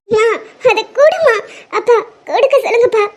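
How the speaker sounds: sobbing, broken begging; crying plea. Acted. Daughter sobbing as she begs her father to make mother return it. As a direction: cry out for the ring back.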